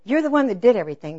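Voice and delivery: mock indignant. Wife firing back at her wounded husband, mock indignant. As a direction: throw the blame back loudly.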